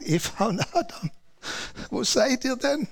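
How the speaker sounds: put-on a father softly looking for his children; gentle loving call. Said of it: "Gott schrie sie nicht an".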